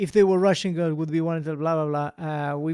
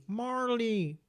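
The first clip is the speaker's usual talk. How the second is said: a hint of Owen Wilson, drawn-out Marley; lightly voiced Owen Wilson calling the dog; Owen Wilson impression; 4 semitones higher, much slower than usual. Quizmaster doing his Owen Wilson impression, lightly voiced. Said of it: "my Owen Wilson impression".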